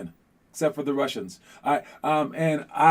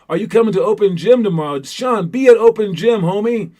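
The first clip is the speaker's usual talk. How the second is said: loud, high call across the gym; 5 semitones higher, 8 dB louder than usual. Real, not acted. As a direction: shout over to a teammate. Calling out to Sean.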